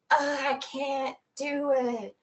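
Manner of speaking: mock dream struggle. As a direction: drag it out like moving in a dream. Imitating slow-motion dream struggle.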